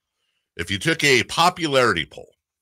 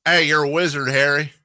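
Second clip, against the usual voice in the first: higher-pitched, hammed-up country twang; badly faked country accent, pushed higher; attempted country accent; 5 semitones higher than usual. Radio host retries the wizard line, exaggerated country accent. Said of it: "I'm not good at country accents".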